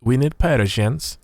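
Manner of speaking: slow, hammed-up Persian accent on "Persians"; mock Persian accent. Shown as a demonstration. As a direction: lean into a playful Persian accent, drawing out "Persians". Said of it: "I should say that in the Persian accent".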